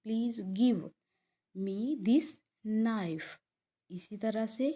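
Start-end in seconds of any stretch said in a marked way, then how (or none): 0.1-3.3 slow, carefully spaced sentence; slow model reading. Shown as a demonstration. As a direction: spell it out in sound for students. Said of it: "गिव की जो sound है वो वी पे ही ख़त्म". (धीमा, साफ़ उच्चारण)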